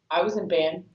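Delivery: low, subdued retold reply; quiet retort. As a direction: drop the pitch for a deadpan comeback. Colleague's hurt reply, retold.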